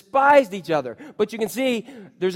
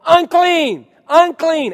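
loud, high cry of a leper; leper's loud warning cry; yelling unclean; 9 semitones higher, 9 dB louder, much slower than usual. Pretend. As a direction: shout the warning so people scatter. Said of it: "I'd have to yell".

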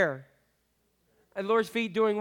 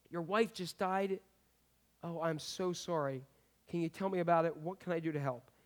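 tender sympathy; 4 semitones lower, 9 dB quieter than usual. Retold or imitated. Retold compassionate reply to widower.

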